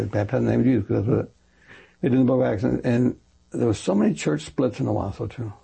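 ordinary talk, nothing marked

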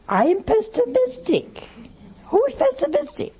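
high-pitched mock indignation; argumentative uncle. Uncle bristles at being called pessimistic, comically argumentative. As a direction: squawk back as the affronted uncle. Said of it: "someone who's argumentative".

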